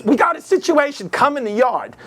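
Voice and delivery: high-pitched mob wife giving orders; Goodfellas mob wife. Retold or imitated. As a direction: summon him in a high wife voice. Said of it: "she looked like from Goodfellas".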